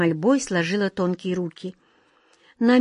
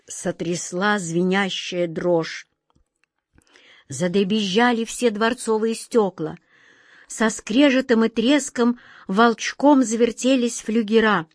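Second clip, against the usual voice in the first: ordinary delivery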